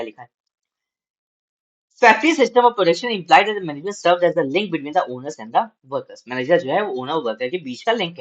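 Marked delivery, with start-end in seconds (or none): 2.0-6.2 slow, measured reading aloud to students; slow classroom read-aloud; slow textbook reading. Acted. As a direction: read each phrase out deliberately for the class.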